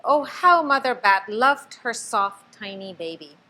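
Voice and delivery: ordinary delivery, nothing marked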